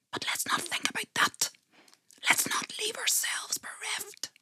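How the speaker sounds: hushed whisper, pulling back from the thought; secretive whisper to the listener. A narrator's reading. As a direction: breathe the words out without voice. Narrator hushes dark thought.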